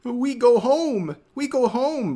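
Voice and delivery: high, pleading mockery of broken English; mimicking the streamer's broken English plea. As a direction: parrot the streamer's begging in broken English, hammed up. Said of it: "begging in broken English to let him go home".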